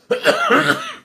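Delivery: harsh hacking cough; smoker's cough. Real, not acted. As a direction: cough hard, chesty and rough. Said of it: "it's my smoker's cough".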